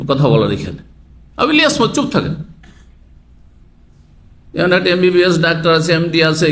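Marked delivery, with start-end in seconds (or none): 1.4-2.3 stern rebuke. Acted. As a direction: snap the name, then order silence. Preacher scolds a listener by name.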